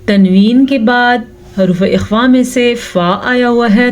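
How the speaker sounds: ordinary talk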